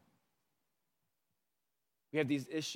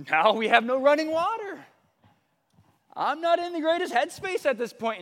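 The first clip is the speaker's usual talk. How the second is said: exasperated; 11 semitones higher, 8 dB louder than usual. Retold or imitated. Stressed retelling, no water.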